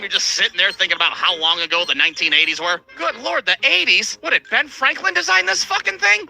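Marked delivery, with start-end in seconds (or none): 3.0-6.3 high, shocked disbelief; incredulous. Real, not acted. Incredulous outburst at old ride.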